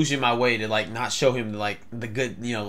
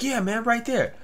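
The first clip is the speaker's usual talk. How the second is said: higher-pitched crazy stranger; drugged stranger; 11 semitones higher than usual. Retold or imitated. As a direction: play the man on drugs, pitched up. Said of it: "either he was crazy or he was on".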